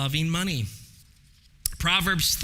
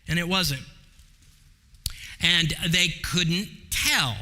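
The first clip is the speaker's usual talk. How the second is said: usual speaking voice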